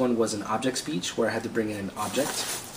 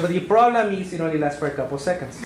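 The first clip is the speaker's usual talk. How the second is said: put-on higher Mexican-accented voice; fake Mexican accent; 5 semitones higher, 4 dB louder than usual. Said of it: "magically your accent disappears".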